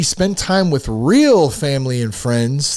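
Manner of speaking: "real" stretched and punched above the rest; stress on real. Real, not acted. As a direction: lean hard on the word "real". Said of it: "I emphasize the word real".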